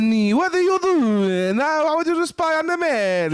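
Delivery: high, hammed-up Italian accent; put-on Italian accent. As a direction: play a spy boss in broad mock Italian. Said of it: "And what accent? This is our accent".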